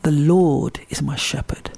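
"Lord" drawn out slowly; slow verse with the weight on "Lord"; stress on Lord. Shown as a demonstration. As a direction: lean long on the word "Lord". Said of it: "I begin with stressing the words in order".

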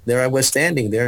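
usual speaking voice

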